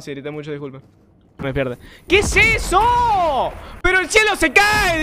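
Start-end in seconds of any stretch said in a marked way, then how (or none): 2.1-3.5 high, slow, drawn-out disbelief; stretched-out startled question; shocked outcry. Real, not acted. Surprised by something in game. 3.8-5.0 high, alarmed cartoon-chicken cry; hammed-up panicked chick; Chicken Little panic. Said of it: "diría Chicken Little".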